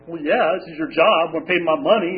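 loud, indignant comeback; angry retort. Retold or imitated. Angry clients answering counselors who asked about their expectations, indignant and loud. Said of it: "so we're angry".

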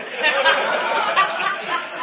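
high, airy laugh; real high breathy laugh. Real, not acted. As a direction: laugh out the embarrassment. Laughing at hiding his car.

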